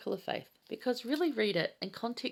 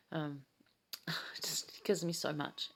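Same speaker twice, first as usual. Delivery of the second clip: near tears; 3 semitones lower, 6 dB quieter, slower than usual. Real, not acted. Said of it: "I'm gonna start crying".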